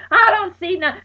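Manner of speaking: high, loud frantic shout; panicked shout. Retold or imitated. Friend unable to see a street sign, frantic shout.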